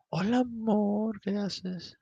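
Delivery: put-on high, slow sweet-talking girlfriend; mock girlfriend voice. Girlfriend calling him at midnight, high and sweet. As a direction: coo it like a late-night caller.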